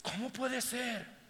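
high, soft, slow disbelief; hushed amazement. Acted. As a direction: marvel under your breath, drawn out. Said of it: "los que están cerca de nosotros dicen". (asombro susceptible de incredulidad, suave y agudo)

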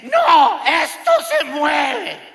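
high, loud, slow shriek of alarm; wife yells that the building moves, mock-terrified; panicked shout. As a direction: imitate the wife crying out in fright.

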